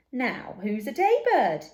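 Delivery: loud, crowing call into the night; triumphant shout. A narrator's reading. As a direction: fling it out at the dark. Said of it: "he shouted at the darkness".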